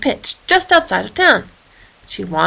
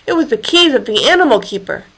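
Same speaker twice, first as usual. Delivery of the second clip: plain reading voice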